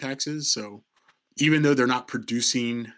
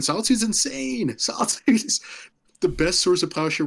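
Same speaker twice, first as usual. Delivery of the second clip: excited laugh; 8 semitones higher than usual. Real, not acted. Enthusing about a card.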